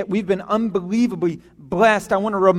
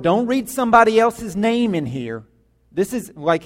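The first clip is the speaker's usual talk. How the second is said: ordinary delivery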